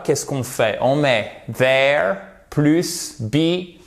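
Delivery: ordinary delivery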